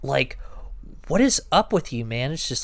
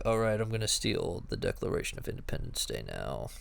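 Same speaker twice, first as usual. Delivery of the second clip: low, quiet, fast sleepy drone; like just woken from a nap; groggy bored Cage; 6 semitones lower, 8 dB quieter than usual. Retold or imitated. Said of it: "he delivers it like a guy who has been, who's had, like, a twelve-hour depression nap".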